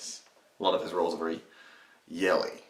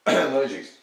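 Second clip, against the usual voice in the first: Warburton voice; 6 semitones higher, 6 dB louder than usual. Pretend. Mocking the Emoji Movie title.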